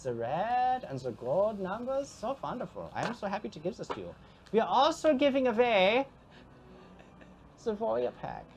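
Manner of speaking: a lightly put-on foreign ghost accent, cheerful and gloating; Alvin the Ghost accent. Ghost NPC gleefully presenting prizes to the chat, mild put-on accent. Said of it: "lose his accent halfway through".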